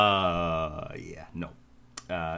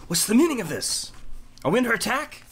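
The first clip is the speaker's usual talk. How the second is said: high, loud alarmed nobleman; sudden high alarm at the intruder; Ismark alarmed; 6 dB louder than usual. Acted. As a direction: bark it out like a startled lord.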